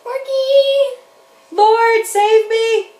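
high, loud desperate plea; cry for help. Retold or imitated. Sinking Peter shouts to Jesus, high and loud. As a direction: shout for rescue while sinking. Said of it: "He cried out".

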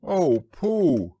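high, loud distressed call; crying out. A narrator's reading. Christopher Robin calling for missing Pooh, crying out. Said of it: "cried Christopher Robin".

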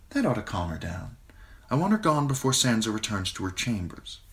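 put-on a queen cooing to a frightened girl; queen Cersei, sweet and smooth. A narrator's reading. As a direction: Cersei soothing Sansa in a honeyed voice. Narrator voices Queen Cersei.

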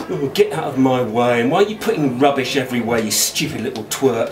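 low, fast, scornful snipe; scornful sister. A narrator's reading. Older sister snapping at her brother: low fast sneer.

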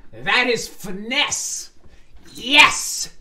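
loud, high, drawn-out cheer; triumphant approval. Real, not acted. Reader applauding Danny's finesse, loud triumphant cheer. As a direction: stretch it, then punch out a big yes.

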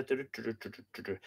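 quiet run of du-du-du; idle nonsense syllables under the breath; soft placeholder babble. Real, not acted. Reader finding the place in the text, soft babbling.

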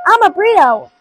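high, loud playful sing-song; silly excited. Pretend. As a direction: belt it out like a silly game.

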